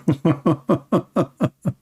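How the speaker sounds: short amused laugh. Real, not acted. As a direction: laugh at your own joke. Laughing at his own story.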